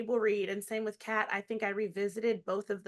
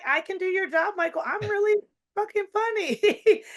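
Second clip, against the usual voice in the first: high, loud cocky character voice; Veranda character voice; 11 semitones higher, 8 dB louder than usual. Retold or imitated. Comedian playing her character to the interviewer, high and cocky. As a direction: brag like an overconfident woman who thinks she's funny. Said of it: "She's like".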